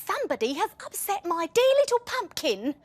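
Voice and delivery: high indignant mother; mum voice. A narrator's reading. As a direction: protective fussing mum. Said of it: "said the mummy person".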